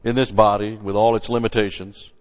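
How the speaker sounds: loud, full-force preaching read; preaching scripture. Acted. Preacher reading scripture to his congregation, full force. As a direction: proclaim the verse to the congregation.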